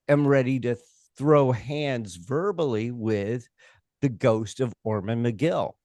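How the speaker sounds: ordinary talk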